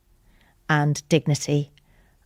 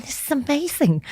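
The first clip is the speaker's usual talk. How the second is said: much higher, bright burst of delight; delighted exclaim; 10 semitones higher than usual. Retold or imitated. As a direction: squeal it with joy. Delighted while moisturising sister.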